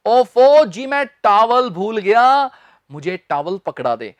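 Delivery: ordinary talk, nothing marked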